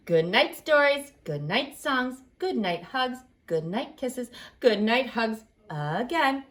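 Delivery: ordinary delivery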